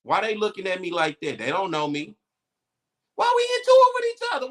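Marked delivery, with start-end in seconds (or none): 3.1-4.5 exasperated outcry. Real, not acted. Exasperated plea to community.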